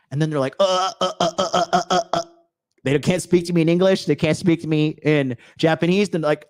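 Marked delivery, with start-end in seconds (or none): 0.6-2.2 string of short, higher "uh" noises; imitated flustered stammer. Imitating people who can't speak to him, flustered stammer. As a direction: stutter like someone lost for words.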